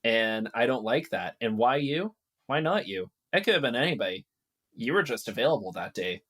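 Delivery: ordinary talk, nothing marked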